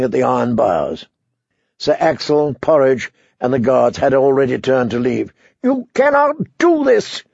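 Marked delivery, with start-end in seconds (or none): 5.6-7.2 high shouted protest; shouting. A narrator's reading. Prisoner shouting at the departing guards, high. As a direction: hurl it at their backs. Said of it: "the prisoner shouted".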